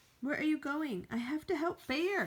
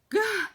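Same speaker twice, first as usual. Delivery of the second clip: loud, high startled gah; startled yell; 9 semitones higher, 8 dB louder than usual. Acted. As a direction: cry out in shock. Startled outburst.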